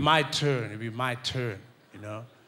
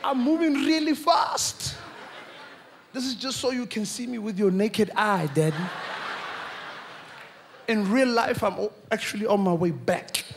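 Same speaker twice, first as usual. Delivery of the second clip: higher, laughing excited explaining; giddy kid; 7 semitones higher than usual. Retold or imitated. Son explaining to angry dad, higher giddy voice. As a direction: rush it out gleefully. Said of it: "I said".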